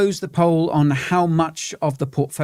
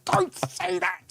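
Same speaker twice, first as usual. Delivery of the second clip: mock panic; 3 semitones higher, slower than usual. Jinx panic after optimism.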